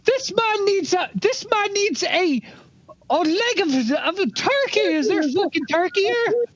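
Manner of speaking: high, slow, drawn-out character voice; blustering Dago character. Acted. Scolding John over his diet, high blustering character voice. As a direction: stretch each word in a high-pitched rant.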